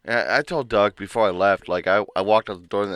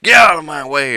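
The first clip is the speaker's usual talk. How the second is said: louder, higher barked order; angry yell; 6 semitones higher, 12 dB louder than usual. Retold or imitated. Coworker ramming empties into him, angry yell. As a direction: shove past with this shout. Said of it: "Comes up with a big old stack of empties".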